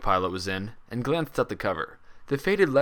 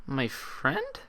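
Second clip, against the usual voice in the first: doubtful; 5 semitones higher, 5 dB quieter than usual. Acted. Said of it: "she asked doubtfully".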